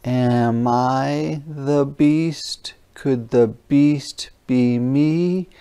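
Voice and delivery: ordinary delivery, nothing marked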